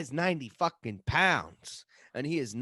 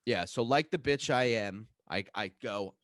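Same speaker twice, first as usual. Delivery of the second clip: ordinary talk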